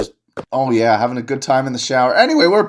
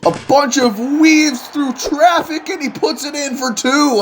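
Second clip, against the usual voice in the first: excited call; 10 semitones higher than usual. Acted. Announcer calls a drive and score.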